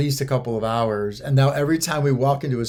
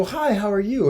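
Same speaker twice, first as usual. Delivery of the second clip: friendly greeting; 8 semitones higher than usual. Retold or imitated. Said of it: "And you go".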